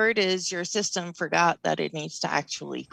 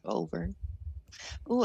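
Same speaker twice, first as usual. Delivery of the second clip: usual speaking voice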